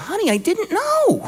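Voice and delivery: squeaky high pleading; high-voiced husband. Husband pleading with his angry wife, very high. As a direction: go way up, helpless. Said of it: "his voice is higher than hers".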